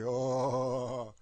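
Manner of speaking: loud, drawn-out mock-menacing groan; mock evil donkey. Mocking the idea that a donkey sounds evil, loud evil donkey voice. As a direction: stretch it out like a hammed-up evil donkey. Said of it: "when he speak, he say".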